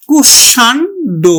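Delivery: কুষাণ্ড drawn out slowly with the ম dropped; কুষাণ্ড stretched, m silent as the wrong rule gives; wrong reading shown. Shown as a demonstration. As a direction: say কুষাণ্ড slowly, leaving out the m sound. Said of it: "উচ্চারণ হওয়ার কথা ছিল কিন্তু".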